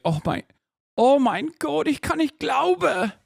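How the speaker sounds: very high-pitched mock German accent; German accent impression. Shown as a demonstration. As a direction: put on a squeaky, drawn-out German exclamation, closer to Austrian. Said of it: "have you ever heard my German accent".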